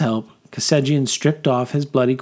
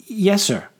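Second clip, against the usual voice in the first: higher-pitched, obedient soldier's reply; eager private; 9 semitones higher than usual. Acted. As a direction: answer your officer promptly, pitched up. Private answers his officer.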